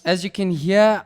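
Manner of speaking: ordinary talk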